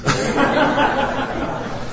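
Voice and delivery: real laugh at the interruption; embarrassed laugh. Real, not acted. Preacher laughing when his watch talks during the sermon. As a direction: laugh it off, caught off guard.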